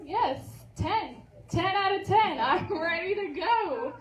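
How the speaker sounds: loud, high burst of excitement; excited. Real, not acted. Student announcing full commitment to the course, excited. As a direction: declare it with rising, whooping enthusiasm.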